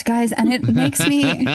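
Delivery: usual speaking voice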